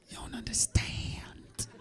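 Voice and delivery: soft unvoiced aside; whisper. Real, not acted. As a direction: lean in and whisper it to the crowd. Aside to the audience.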